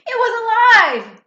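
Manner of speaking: high, loud shocked cry; alarmed exclamation. A narrator's reading. Narrator revealing the bunny is alive, high and loud. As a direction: blurt it out in horror. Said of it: "narration of the shock".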